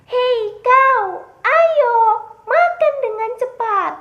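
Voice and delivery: high, loud shout to hurry someone up; urgent calling shout. Acted. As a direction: call out loudly, high and impatient.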